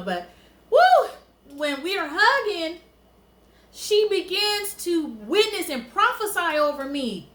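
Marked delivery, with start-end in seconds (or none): 0.7-1.1 high, loud "woo"; emotional whoop. Real, not acted. Woman fighting back emotion as she tells it, emotional whoop. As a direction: let out a sudden high whoop. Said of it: "I'm about to get emotional".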